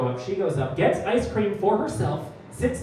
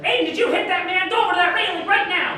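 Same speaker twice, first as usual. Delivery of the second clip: high stern mom scolding; 13 semitones higher, 4 dB louder than usual. Retold or imitated. Comic imitating the mom freaking out at her son, high scolding. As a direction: bark orders like a flustered mother, pitched up. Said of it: "She's like".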